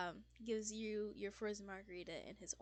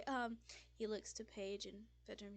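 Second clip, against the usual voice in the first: ordinary delivery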